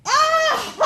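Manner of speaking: high, loud laughing squeal; gleeful prank shriek. Retold or imitated. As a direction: burst out laughing at the joke.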